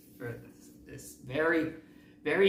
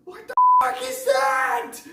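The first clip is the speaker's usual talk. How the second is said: shrieking scream, much higher and louder; freaking out; 13 dB louder, slower than usual. Retold or imitated. Tom spots Sonic in his garage, panicked high shriek. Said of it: "of course he's freaking out".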